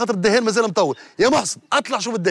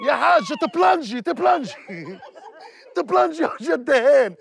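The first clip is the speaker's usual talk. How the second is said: high call breaking into laughter; laughing call; 7 semitones higher than usual. Real, not acted. Teasing the old lady to ululate, laughing high call. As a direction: tease her through laughs.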